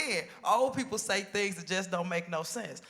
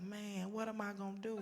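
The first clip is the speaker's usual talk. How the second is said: much softer, deflated lament; despairing; 12 dB quieter than usual. Retold or imitated. Dumped woman asking herself, soft mock despair. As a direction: say it like the floor dropped out.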